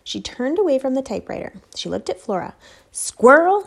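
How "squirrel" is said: higher, louder, startled question; shocked to see the squirrel; surprised. Acted. Mother sees the squirrel, startled and loud.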